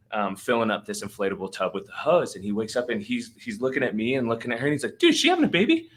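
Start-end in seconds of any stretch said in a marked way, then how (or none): 5.0-5.9 very high, loud mimicked panic; elevated excited voice. Buddy waking to a home birth, excited high voice. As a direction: imitate an excited buddy squealing a question. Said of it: "his voice starts to elevate".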